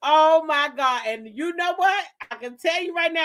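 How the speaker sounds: ordinary talk, nothing marked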